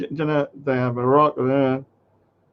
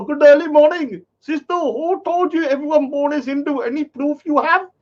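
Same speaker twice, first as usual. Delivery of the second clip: higher, singsong mock Indian accent; mocking Indian accent reading the comment; 9 semitones higher than usual. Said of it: "his bloody Indian accent showing through".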